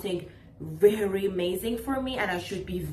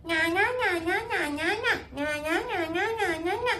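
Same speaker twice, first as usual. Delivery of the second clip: high, sing-song mocking babble; mocking nya nya; 7 semitones higher, much faster than usual. Mocking a parent who only talks negatively, high sing-song babble. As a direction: mimic a nagging parent's chatter as noise. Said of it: "They're just like".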